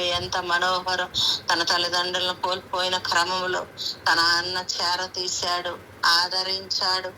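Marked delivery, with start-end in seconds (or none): none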